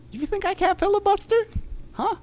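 squeaky high taunting voice; mock a taunt up high.

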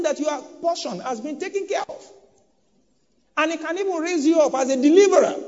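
loud, rising sermon cry, high and strong; preaching at full force. Acted. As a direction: preach it out with full fire to the congregation.

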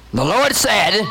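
high-pitched overzealous proclamation; mock preaching. Minister pouncing on a stranger in a taxi, high mock proclaiming. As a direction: blurt it out like a pushy street evangelist.

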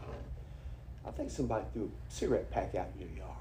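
hushed, fast fake-grave news; mock confidential. Preacher teasing his friend about litter, hushed.

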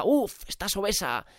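high exclamation of feigned dismay; mock horror. Mimicking social media's body-shaming, mock-horrified. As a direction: make a mock drama of it. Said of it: "Parece un drama de la hostia".

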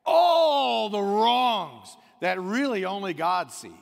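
loud, slow, ringing pulpit cry; full-force preaching. Acted. Preacher crying out to the congregation, loud and slow. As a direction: preach it out with everything.

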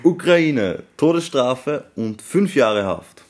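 high, loud, slow results reveal; hyped giveaway announcement. Pretend. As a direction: announce it like a song contest jury. Mock Eurovision jury results.

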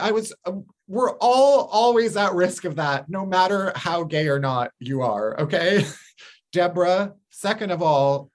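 ordinary talk, nothing marked

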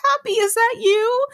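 high, overjoyed call to his dog; the man finds his guide dog again, calling out high and happy; blind man's joy. Retold or imitated. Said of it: "He was like".

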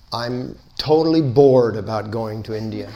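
laughing through the words; chuckling as she says it. Real, not acted. Amused speaker teasing the audience, laughing.

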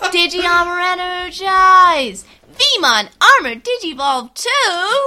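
high, loud, slow heroic shout; battle cry. Acted. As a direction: call it out like an anime transformation. Shouting a digivolution call.

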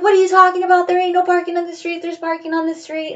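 high, loud bossy grandma voice; grandmother scolding. Retold or imitated. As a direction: cut him down like a fierce grandmother. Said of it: "She's like".

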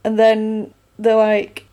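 ordinary delivery

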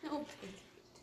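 soft breathy whisper. Acted. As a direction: whisper it back, slowly. Echoing friend, breathy aside.

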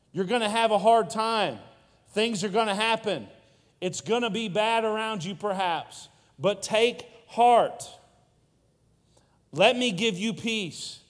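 rally them with rising conviction; fervent preaching. Acted. Pastor urging the youth crowd to take heart, impassioned and loud.

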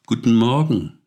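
stork's loud, high greeting from above; calling out. Acted. Stork Adebar greets the sheep and wolf, calling out. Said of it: "rief er".

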